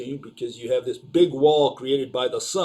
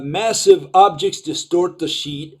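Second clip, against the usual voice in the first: ordinary talk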